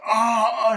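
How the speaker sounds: high, loud mock scream; drawn-out cry of a movie victim; imitated scream. Wires round the other guy's neck, imitated scream.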